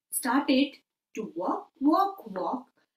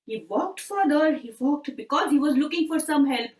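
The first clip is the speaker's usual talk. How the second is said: usual speaking voice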